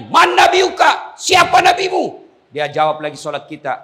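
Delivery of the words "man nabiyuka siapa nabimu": high booming shout, slow on the first question; angel's shouted demand. Acted. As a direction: bellow the question at him. Angel interrogates the dead man.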